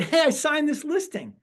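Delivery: high and slow, proud announcement; bursting to tell the office; excited rookie. Retold or imitated. New agent proudly telling the office he signed, high and slow. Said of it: "I'm super excited. I'm fired up".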